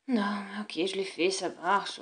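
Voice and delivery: whispered, grudging reply to mom; sulky whisper. Acted. Child whispering back at his mother, sulky. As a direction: breathe it out, annoyed.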